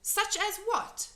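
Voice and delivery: put-on high, light girlish voice; little girl voice. A narrator's reading. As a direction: play young Sophie, kind.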